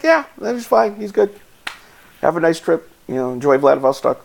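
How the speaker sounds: high, laughing officer waving him through; a cheery border guard letting him go; friendly officer voice. Retold or imitated. Officer to traveller after chatting in Chinese, a high cheery send-off. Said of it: "He's like".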